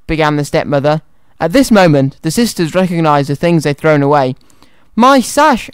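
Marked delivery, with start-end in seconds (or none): 4.9-5.7 high, mild wail; high-pitched storybook lament. A narrator's reading. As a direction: wail in dismay, without much volume. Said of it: "Anastasia wailed".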